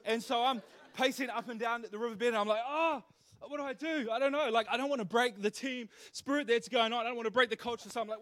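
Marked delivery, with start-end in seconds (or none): 2.7-7.9 mock panic, pitched up; flustered dithering. Preacher replays pacing the riverbed unsure what to do, flustered. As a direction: act out helpless fretting in a higher voice.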